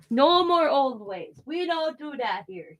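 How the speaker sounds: slow, high-pitched doctor imitation; playful mimicry of the doctor's refusal; doctor impression. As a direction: draw it out with a high arc, then drop off. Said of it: "that's the way he talks".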